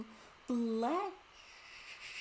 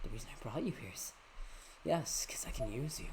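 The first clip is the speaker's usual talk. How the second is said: low villain voice; 5 semitones lower, 6 dB quieter than usual. Shown as a demonstration. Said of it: "I was using the wrong voice".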